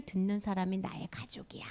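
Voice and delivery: 속삭이듯 말하기; hushed storyteller whisper. Acted. 예수님 말씀을 속삭임으로. (숨죽여 속삭이기)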